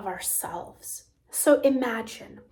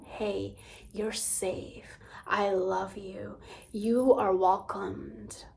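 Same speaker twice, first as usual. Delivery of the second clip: soft, slow whisper, much softer; tender reassurance; 3 semitones lower, 7 dB quieter, slower than usual. Pretend. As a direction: hush the frightened child gently in a whisper. Comforting the sad inner child.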